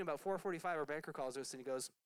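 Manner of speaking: ordinary delivery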